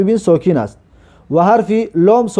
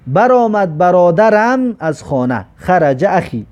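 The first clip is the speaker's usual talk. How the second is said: normal talking voice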